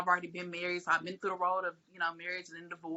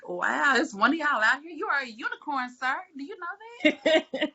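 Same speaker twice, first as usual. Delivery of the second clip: higher, delighted and laughing; amazed; 7 semitones higher than usual. Retold or imitated. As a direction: marvel at him, a rare find. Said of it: "and I was like".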